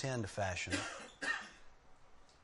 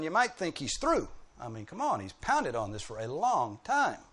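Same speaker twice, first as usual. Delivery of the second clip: normal talking voice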